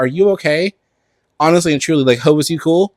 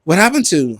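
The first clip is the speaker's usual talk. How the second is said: ordinary talk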